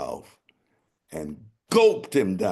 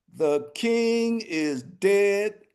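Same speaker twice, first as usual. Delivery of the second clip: crowing proclamation; 14 semitones higher, much slower than usual. Acted. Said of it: "repeated proclamation".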